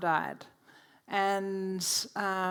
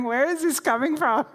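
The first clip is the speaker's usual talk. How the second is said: laughing; 6 semitones higher, 5 dB louder than usual. Retold or imitated. Laughing while retelling surprise.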